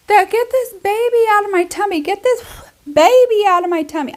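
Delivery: high-pitched imitation of a distressed child; imitating freaking-out son. Mom imitating her son freaking out in the hallway, high-pitched child voice. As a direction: put on a small child's high, urgent wail. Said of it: "you can hear him kinda being like".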